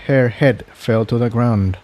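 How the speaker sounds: ordinary delivery, nothing marked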